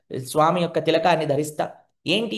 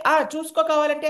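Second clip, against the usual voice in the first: put-on cocky child; 11 semitones higher, 5 dB louder than usual. Child bluffs about homework.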